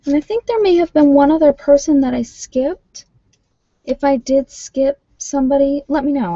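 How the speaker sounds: lifted, high and eager; bright high talking voice. Real, not acted. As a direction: pitch up as she reaches out to a viewer.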